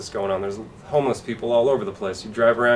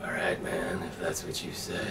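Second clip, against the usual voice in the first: low, much softer, resigned mumble; resigned musician; 5 semitones lower, 12 dB quieter than usual. Retold or imitated. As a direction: give in with a low shrug.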